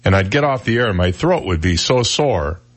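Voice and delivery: ordinary talk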